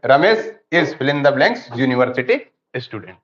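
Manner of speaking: slow, deliberate reading of each blank; slow dictation reading. Shown as a demonstration. Teacher reading exercise sentences aloud to the class, slow and deliberate. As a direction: spell out the exercise sentences slowly.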